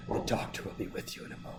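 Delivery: fast, soft unvoiced murmur; whispered receptionist. Receptionist to the patient, a quick soft whispered murmur. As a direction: rush the line under your breath. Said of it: "She's like".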